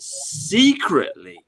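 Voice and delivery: secretly stretched long and slow, stressing the hidden feeling; drawn-out secretly. Acted. Host to listeners, slowly drawing out "secretly". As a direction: linger on "secretly" as if letting them in on it. Stressing what they think in private.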